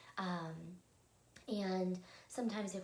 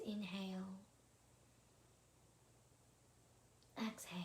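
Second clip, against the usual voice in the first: guided meditation; 3 dB quieter than usual. Acted. Host leading listeners through evening breathing, calm guided meditation.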